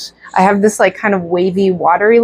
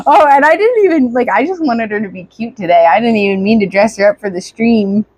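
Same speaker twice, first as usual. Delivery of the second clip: normal talking voice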